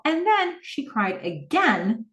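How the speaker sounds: slow, held stress on "again" after a high start; slow, weighty retelling of "cried again"; drawn-out emphasis. Retold or imitated. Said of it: "He holds this for emphasis".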